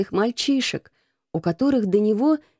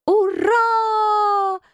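cheering shout; 13 semitones higher than usual. A narrator's reading. Street boys cheering the Rat's plan, shouting. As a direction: yell it with wild delight. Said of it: "завопили мальчики".

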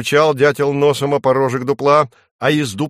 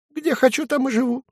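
much higher, squeaky small-animal voice; a cheeky little dormouse pleading its case; tiny dormouse squeaking at the woodpecker; 13 semitones higher, faster than usual. Acted. Dormouse character voice.

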